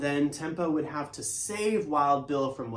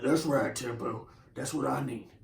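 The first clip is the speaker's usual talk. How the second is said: cowboy voice; 4 semitones lower than usual. Acted. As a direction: lay out the shopping list like a relaxed rancher. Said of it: "and he sounds like this".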